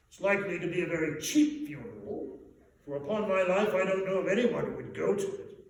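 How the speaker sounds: loud, high blustering merchant; great fat man. A narrator's reading. Fat businessman dismissing the talk, loud blustering voice. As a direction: bark it out like a stout businessman. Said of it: "said the man with the chin".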